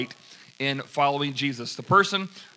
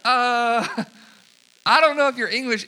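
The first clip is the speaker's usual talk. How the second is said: much higher, louder, taken aback; stunned disbelief; 8 semitones higher, 6 dB louder, slower than usual. Retold or imitated. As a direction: blurt it out, floored. His own stunned reply.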